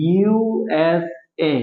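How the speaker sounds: ইউএসএ drawn out as three separate letters, U, S, A. Shown as a demonstration. Teacher showing students an abbreviation, ইউএসএ spelt out slowly. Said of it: "আলাদা আলাদা করে উচ্চারণ করতেছি".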